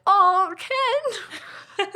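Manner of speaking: high, laughing "Oh, Ken"; swooning Barbie. Retold or imitated. Mocking Barbie's opening, a high laughing swoon. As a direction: gush over Ken with a laugh. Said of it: "we kick it off with".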